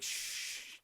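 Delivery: breathy unvoiced shush; whispered shush. Woman hushing her hookup, whispered shush. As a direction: hush him with a whisper. Hushing him during the encounter.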